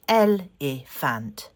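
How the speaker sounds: "Elephant" said slowly, three clear beats; careful three-beat "Elephant"; own pronunciation. Shown as a demonstration. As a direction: repeat "Elephant" slowly, syllable by syllable. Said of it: "the way I say it is".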